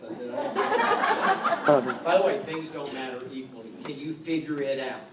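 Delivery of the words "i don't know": drawn-out, laughing, higher and louder; coy fake modesty, stretched out; mock modest. Coy reply to son, laughing.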